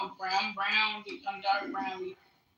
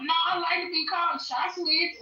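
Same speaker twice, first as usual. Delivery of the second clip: emphatic; 6 semitones higher, 4 dB louder than usual. Real, not acted.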